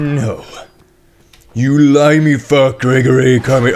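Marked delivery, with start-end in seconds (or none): 1.5-3.7 angry cursing. Real, not acted. Gamer cursing an enemy, loud and angry. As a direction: draw out the insult loudly at a foe.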